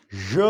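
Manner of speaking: J as "zhuh", not the letter name; isolated "zhuh" for J; voiced consonant demo. Shown as a demonstration. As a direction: sound out J softened to "zh" plus a short vowel.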